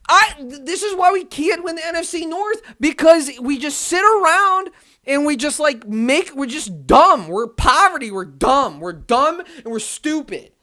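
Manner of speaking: loud, high exasperated tirade; real fed-up shouting; angry rant. Real, not acted. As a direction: shout it, slowing down at the end. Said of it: "the whole entire rant".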